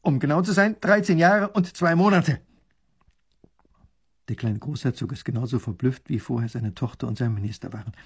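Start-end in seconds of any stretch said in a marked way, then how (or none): 0.0-2.4 high, loud, proud precision. A narrator's reading. Soldier giving the exact time served, proudly. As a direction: correct it with soldierly pride. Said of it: "bekräftigt stolz der Soldat".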